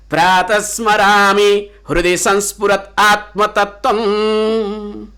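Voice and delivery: slow, low Sanskrit chant; verse recitation. Acted. Teacher to students, slow chanted verse. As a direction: intone the verse slowly.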